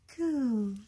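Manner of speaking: long, high, gliding coo; baby ghost coo. A narrator's reading. Baby ghost heard quite plainly, cooing.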